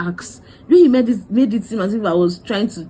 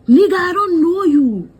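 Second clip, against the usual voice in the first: indignant retort; 9 semitones higher, 6 dB louder than usual. Retold or imitated. Rejecting the stranger's claim.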